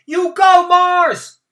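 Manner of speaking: high, loud cheering shout; called out. A narrator's reading. Spectator cheering for Mars Bar, loud shout. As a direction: cheer the runner on from the crowd. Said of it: "someone called out".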